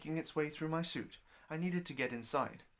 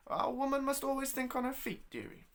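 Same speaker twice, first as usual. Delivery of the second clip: put-on much higher, louder crafty old woman; proud old landlady; 11 semitones higher, 4 dB louder than usual. Landlady proudly justifying the barn room, high and crafty. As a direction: boast it like a sly old innkeeper. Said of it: "she said proudly".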